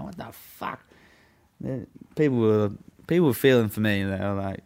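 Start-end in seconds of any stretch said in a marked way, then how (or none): 0.0-0.8 voiceless, hushed bystander reaction; whispered disbelief. Retold or imitated. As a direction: breathe it out like a shocked onlooker. Onlookers muttering in disbelief.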